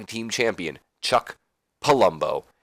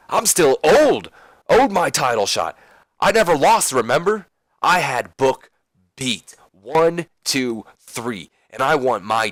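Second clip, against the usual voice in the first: loud, higher-pitched indignant protest; pretty pissed; 4 semitones higher, 4 dB louder, slower than usual. Acted. Wrestler demands his owed title shot from the boss, pissed off. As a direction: argue your case with heated, punched words. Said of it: "he's pretty pissed".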